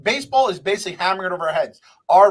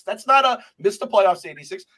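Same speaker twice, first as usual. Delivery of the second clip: ordinary talk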